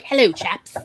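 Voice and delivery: put-on British accent. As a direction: drop into a jolly British accent. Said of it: "suddenly break her French accent".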